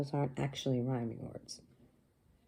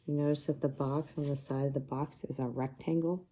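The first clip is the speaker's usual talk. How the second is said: usual speaking voice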